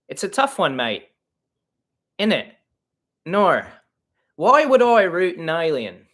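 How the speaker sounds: hammed-up Aussie accent; Australian accent. Shown as a demonstration. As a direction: put on a broad Australian accent. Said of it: "Something like that".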